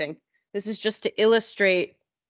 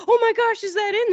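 high, louder delighted gasp; thrilled gasp; 13 semitones higher, 4 dB louder than usual. Retold or imitated. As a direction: hear your bike running and light up. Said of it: "I was like".

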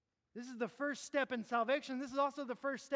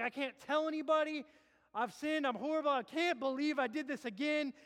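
despairing sinner; 4 semitones higher, 5 dB louder than usual. Retold or imitated.

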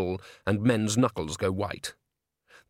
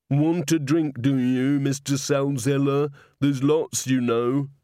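simple-minded Walter; 3 semitones higher, slower than usual. A narrator's reading. Simple Walter offering drinks.

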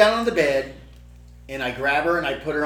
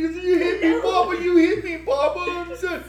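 squeaky-high mock sobbing; imitating crying toddler; 4 dB louder than usual. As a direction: whimper and wail like a hurt little child. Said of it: "And she's crying".

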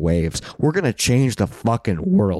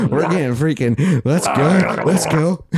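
laughing hype; 6 semitones higher, much slower than usual. Real, not acted. Laughing drink cheer.

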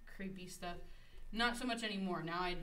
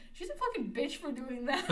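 higher, indignant mimicry edged with laughter; mad venting, retold; 6 semitones higher, 3 dB louder than usual. Classmate venting about the teacher, mock-mad retelling. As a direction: imitate a furious classmate, amused. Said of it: "she was so mad".

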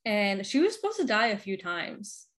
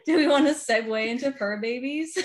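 laughing; 4 semitones higher, 7 dB louder than usual. Real, not acted. Laughing segue announcement.

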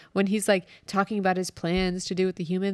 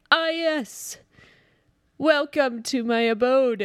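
high slow pompous welcome; hoity-toity villain voice; 5 semitones higher, slower than usual. Retold or imitated. Host imitating Travolta's villain welcoming a diplomat, pompous high voice. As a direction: play a snooty alien lord greeting a guest. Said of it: "starts talking in this strange way".

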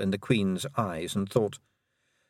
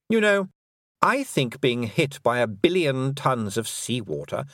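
higher, silky voice of a mocking fairy queen; lightly higher, condescending queen; 6 semitones higher than usual. A narrator's reading. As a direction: purr it out as the cruel Queen, sweetly taunting.

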